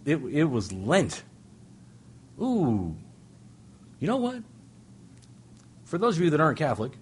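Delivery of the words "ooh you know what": high, surprised "you know what"; sudden realization. Real, not acted. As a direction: snap to a bright new thought. Sudden realization mid-story.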